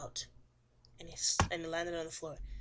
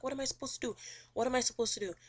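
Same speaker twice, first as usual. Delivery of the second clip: higher-pitched, mock helpless questioning; play-acted desperation; retold desperate plea; 5 semitones higher, faster than usual. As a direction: plead it higher, as a retelling. Evicted woman's retold desperate question.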